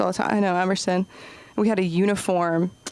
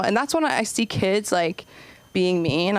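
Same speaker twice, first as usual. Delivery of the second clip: ordinary talk, nothing marked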